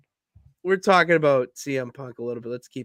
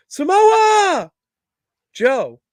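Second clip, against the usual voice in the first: "Samoa" shrieked much higher and louder, fading on Joe; screamed name; 13 semitones higher, 9 dB louder, much slower than usual. Retold or imitated. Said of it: "He goes".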